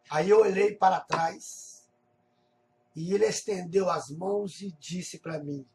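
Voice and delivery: ordinary delivery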